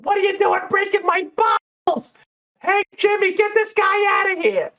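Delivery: shrill, very high bartender shouting; high-pitched angry yell in character. Acted. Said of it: "this shrill voice go".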